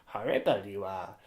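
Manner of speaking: a hint of Yoda in a teacher's voice; lightly voiced Yoda-ish Philippe. [name]'s Philippe impression, drifting toward Yoda. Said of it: "more like Yoda".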